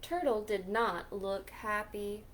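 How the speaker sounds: usual speaking voice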